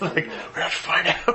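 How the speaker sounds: laughing while talking; amused, giggling through it. Real, not acted. Laughing over nerds' persistence.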